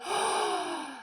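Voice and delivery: long breathy gasp; awed gasp. Retold or imitated. Acquaintance sees the pythons, long amazed gasp. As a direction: suck in air in amazement. Acts out awe at holding snakes.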